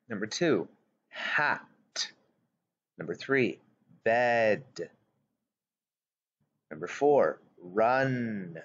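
slow, deliberate word list with long pauses; dictation read. Acted. Teacher dictating worksheet words to young pupils. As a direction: read each word clearly for the children to write.

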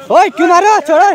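high, loud shout as blows start; alarmed protest. Real, not acted. Protesting to the gang as they start hitting, high shout. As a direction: shout at them to let go. Argument turns into a physical fight.

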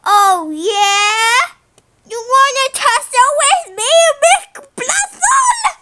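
loud, rising to a high yell; mock-angry challenge. Challenging Blue to tussle, mock-angry yell. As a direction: build from taunt to high, drawn-out shout.

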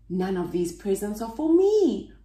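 ordinary delivery, nothing marked

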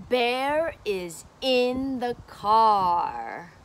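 slow, clear teaching read. Shown as a demonstration. Teacher reading book to child, slow and clear. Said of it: "I'm gonna read the book to you".

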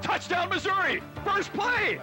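high excited call; touchdown call. Real, not acted. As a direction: burst out with the touchdown.

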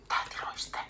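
breathy whispered name, hushed. A narrator's reading. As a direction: whisper the horse's name in fear. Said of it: "Liisa kuiskaa".

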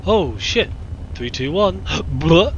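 loud, high frightened countdown; scared gasp. Real, not acted. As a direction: brace for the scare, gasping.

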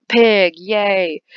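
loud, drawn-out cheer; big celebratory yell; excited cheer. Real, not acted. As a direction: shout it with glee. Cheering at finding a pig.

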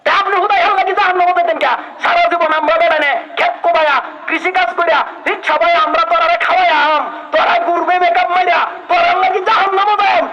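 fiery sermon voice, rising to a shout; preaching at full force. Acted. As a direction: thunder it out like a fiery village preacher. Preacher thundering at the men.

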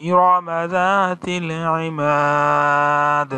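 slow, drawn-out melodic recitation; Quran recitation. Shown as a demonstration. Teacher reciting Surah Fajr for students, slow melodic chant. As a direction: chant each verse slowly, stretching the vowels.

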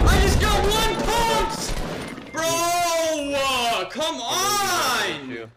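frustrated yelling; raging gamer. Real, not acted. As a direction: cry out in disbelief. Shotgun hit, shouting.

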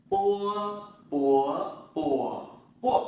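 "pua" with unaspirated p, loud and high, four tones; Chinese pinyin drill. Shown as a demonstration. Teacher drilling students through "pua". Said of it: "Chúng ta làm lại này".

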